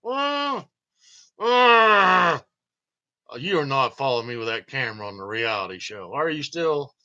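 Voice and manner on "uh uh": high, slow cursing actor, ending in a loud shout; mock actor tantrum. Actors coming off stage cursing at camera, high mock rant ending in a shout. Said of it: "They're like".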